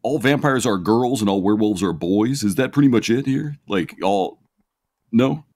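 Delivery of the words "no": ordinary talk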